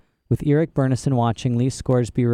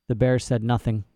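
plain reading voice

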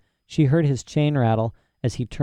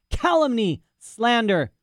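pitched-up indignant cry of calumny; mock outraged old scholar; 11 semitones higher, slower than usual. Indignant outburst.

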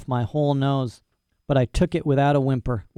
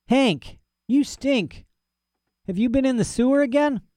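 much higher, disgusted cowboy voice; rancher's voice; 11 semitones higher than usual. Acted. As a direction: wrinkle your nose at the dog. Rancher scolds smelly dog.

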